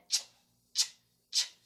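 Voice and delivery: short sharp puffs of "ch"; teacher demo of a clipped "ch"; ch sound. Shown as a demonstration. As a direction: bursts of "ch, ch, ch". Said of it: "has a C H sound in it".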